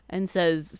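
ordinary delivery

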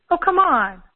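high, loud imitated brush-off; exasperated protest. Friend caught being mean protests, loud mock exasperation. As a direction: burst out as a friend dismissing the fuss.

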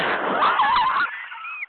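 very high-pitched laugh; high cackle. Real, not acted. After daring toothless rival to bite, high cackle. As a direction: burst into a squealing laugh.

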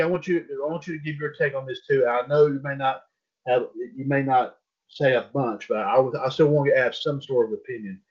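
ordinary talk, nothing marked

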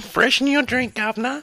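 very high, hammed-up Cockney accent; bad English accent. Co-host quoting The Simpsons at the others, silly English accent. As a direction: pipe it up as a cartoon Englishman. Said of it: "he's from England".

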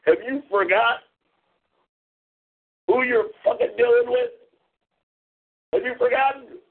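high-pitched outraged demand; indignant challenge. Real, not acted. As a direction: push the question up in affront.

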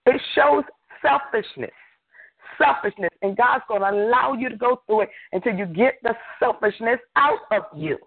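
high-pitched, emphatic sermon delivery; forceful preaching. Real, not acted. Preacher urging listeners to stop giving in to the devil, forceful. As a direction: preach it hard, pitched well up.

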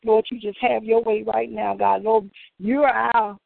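ordinary talk, nothing marked

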